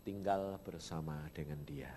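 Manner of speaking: soft, low whisper; whispered longing. Retold or imitated. Preacher retelling his wish to live with God, whispered. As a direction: breathe the wish like a secret prayer.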